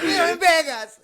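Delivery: high-pitched cry of protest; mock aggrieved outburst. Angry stranger protests to the man apologizing, aggrieved. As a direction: imitate a worked-up little guy. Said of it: "I know he's pissed".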